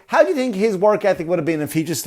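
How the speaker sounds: ordinary talk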